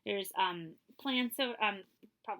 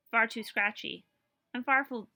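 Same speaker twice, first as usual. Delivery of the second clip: plain reading voice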